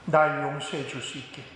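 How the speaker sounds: Jesus asking forgiveness softly; gentle plea. Retold or imitated. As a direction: ask for forgiveness tenderly. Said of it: "주님이 말씀하세요".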